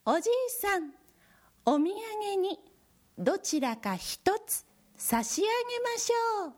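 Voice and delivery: high squeaky little mouse. Acted. Mouse offers a gift.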